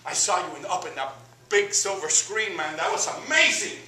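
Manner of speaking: a giddy hometown pal catching up; starstruck old buddy. Retold or imitated. Voicing Ismael at length.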